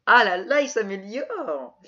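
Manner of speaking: « Ah là là » lancé haut et fort; s'exclamer, amusé et moqueur; exclamation amusée. Real, not acted. Réaction amusée au partenaire. (ravi, exclamation forte)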